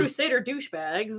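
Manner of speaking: announcer voice. Pretend. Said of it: "announcer for, like, a show".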